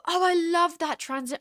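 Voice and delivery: high, drawn-out British slip; accidental British accent. Real, not acted. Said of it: "I became British".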